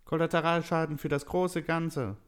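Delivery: high-pitched elf villain; dismissive elf. A narrator's reading.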